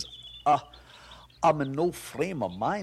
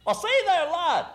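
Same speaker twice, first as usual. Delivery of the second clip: calling out; 10 semitones higher than usual. Acted. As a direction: hail him from outside, trying to sound harmless. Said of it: "I think I'll call out".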